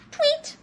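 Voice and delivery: very high chirped tweet; single piping bird call. Acted. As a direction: imitate a little bird with a squeak. Pig now imitates a bird.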